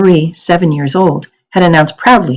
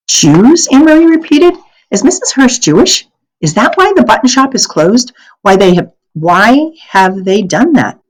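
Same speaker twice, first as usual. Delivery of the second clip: put-on alarmed girl; 7 semitones higher, 3 dB louder than usual. A narrator's reading. Anne-Marie shocked questions.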